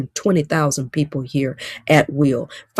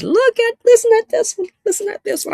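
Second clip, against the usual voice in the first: much higher, thrilled call to listen; excited reading. Real, not acted. Coach excitedly spotting a viewer comment, high and thrilled. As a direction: squeal with delight at a comment.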